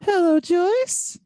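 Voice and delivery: high, loud, drawn-out hello; exaggerated singsong greeting; put-on caller voice. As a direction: call it out in a silly high voice. Mock call to Joyce.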